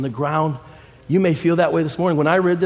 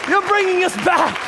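preaching at full force. Acted. Preacher exhorts the congregation.

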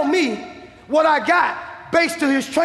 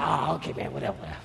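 dismissive doctor; 12 semitones lower, 12 dB quieter, much faster than usual. Retold or imitated.

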